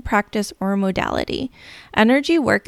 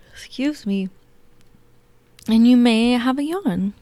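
guided meditation. Acted. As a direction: lull them gently into calm.

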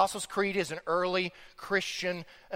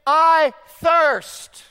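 loud, high, drawn-out cry; 10 semitones higher, 12 dB louder than usual. Retold or imitated. Preacher voicing rich man in agony, crying out. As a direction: cry out each word. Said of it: "he cries out to Father Abraham".

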